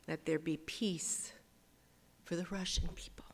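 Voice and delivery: soft whispered petition; hushed plea for peace; whispered prayer. Acted. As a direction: pray it in a hush.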